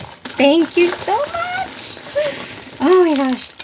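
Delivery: high, slow, overwhelmed thank you; drawn-out thanks, voice shooting up; squealing thanks. Real, not acted. Overwhelmed by the gift.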